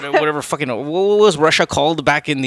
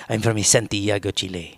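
low-key imitation of a Chilean accent; Spanish-accented place names, pitched low; 5 semitones lower, 4 dB quieter than usual. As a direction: drop lower into a soft Chilean Spanish lilt. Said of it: "He doesn't have the Chilean accent".

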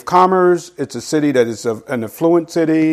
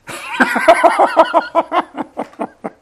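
high amused laugh. Real, not acted. Laughs at Paul seen unimpressive.

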